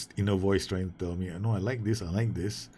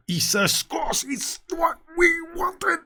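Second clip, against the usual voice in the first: very high, loud, cry-like yell of joy; ecstatic goal celebration; 5 dB louder, slower than usual. Real, not acted. Gamer celebrating his striker's goal, ecstatic yell. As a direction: scream with delight at the goal.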